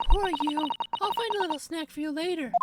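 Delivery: put-on bright high polite young woman. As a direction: lift the voice way up, sweet and proper. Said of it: "her voice is going to tear me apart".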